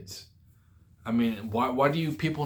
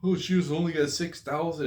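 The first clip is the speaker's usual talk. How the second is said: mocking quote; 6 semitones higher than usual. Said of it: "somebody posted, like".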